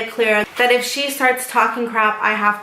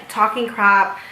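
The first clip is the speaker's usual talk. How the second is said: ordinary delivery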